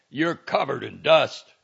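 shouting from a car window to his friend; shouted. A narrator's reading. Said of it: "Valenti shouted".

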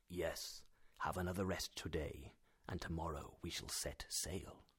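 low, soft reassurance; hushed, low and measured; calming. A narrator's reading. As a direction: quietly settle the patient down. Uncle calms patient, advising rest.

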